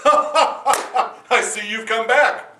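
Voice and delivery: very slow, high, loud chuckling greeting; laughing king. Acted. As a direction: savour the return with a laugh.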